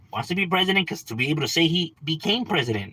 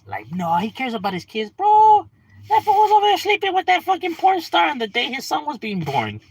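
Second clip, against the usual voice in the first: sarcastic mock outrage; 13 semitones higher, 4 dB louder than usual. Sarcastic retort, much higher.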